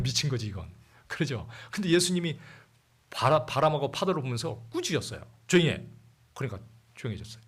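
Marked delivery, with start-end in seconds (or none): 5.5-5.9 loud, high command to be still; commanding rebuke. Retold or imitated. Jesus ordering the wind and waves to be still. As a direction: rebuke the storm with authority.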